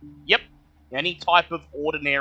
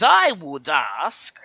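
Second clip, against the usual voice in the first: high, slow, formal little kobold; 4 semitones higher, 3 dB louder, slower than usual. Acted. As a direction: speak as a polite high-pitched kobold. GM voices kobold.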